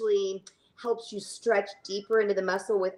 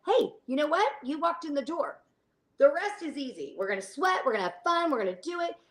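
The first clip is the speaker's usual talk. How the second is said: higher-pitched upbeat pep talk; congratulating class members; 7 semitones higher than usual. Retold or imitated. Instructor congratulating everyone who showed up, upbeat. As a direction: encourage them with lilting, rising phrases. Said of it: "I congratulate everyone. I'm like".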